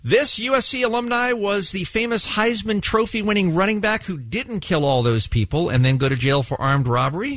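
ordinary delivery

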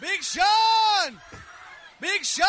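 high shout across the carpet; shouting a name. Real, not acted. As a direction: yell his name to get attention. Shouting to a passing celebrity.

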